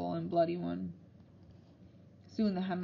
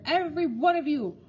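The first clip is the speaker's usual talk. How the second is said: louder, higher, booming orders; angry headmaster; 5 semitones higher, 6 dB louder than usual. A narrator's reading. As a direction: lay down the punishment in anger. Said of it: "he thundered".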